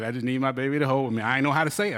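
ordinary delivery

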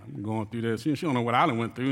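ordinary delivery

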